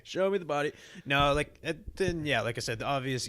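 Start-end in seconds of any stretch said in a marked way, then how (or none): 0.0-0.7 mock demand. Playfully echoing the other's shout.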